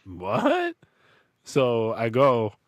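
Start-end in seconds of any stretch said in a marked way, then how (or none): 0.0-0.7 much higher startled what; surprised what. Retold or imitated. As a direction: shoot it up in disbelief. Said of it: "And I'm like".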